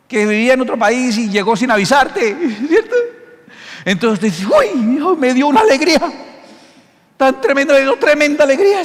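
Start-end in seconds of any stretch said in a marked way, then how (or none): 4.5-8.8 high, louder overjoyed gush; joyful surprise. Retold or imitated. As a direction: gush like your son just walked in. Hijo llega sin avisar. (alegría desbordada, aguda y fuerte)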